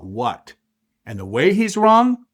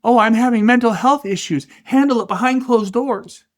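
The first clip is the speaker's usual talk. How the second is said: high, whiny mock of a complainer; 8 semitones higher than usual. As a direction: whine it out like a mockery. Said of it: "He even did the little voice ... the mocking, whiny voice".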